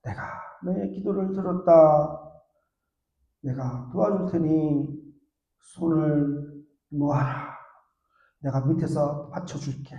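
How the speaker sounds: put-on God answering the young man gently. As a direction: speak slowly and soothingly as God. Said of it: "하나님께서 청년에게 이렇게 말합니다".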